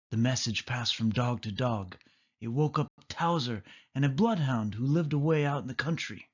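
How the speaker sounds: usual speaking voice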